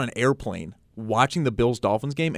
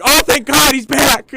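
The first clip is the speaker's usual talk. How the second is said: loud, high burst of relief; re-enacted jubilant yell; retold relieved shout; 12 semitones higher, 7 dB louder, slower than usual. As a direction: cry out as if rescued from disaster. Retells relief when penalty is called.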